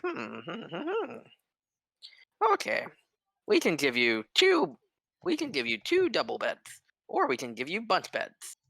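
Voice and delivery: a host thinking aloud over his ledger; lightly voiced musing innkeeper; innkeeper pondering.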